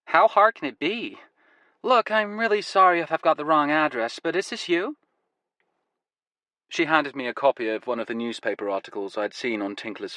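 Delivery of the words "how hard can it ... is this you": put-on higher, louder young American woman, American accent; apologetic American visitor, American accent; eager young American woman, American accent. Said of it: "She had an American accent".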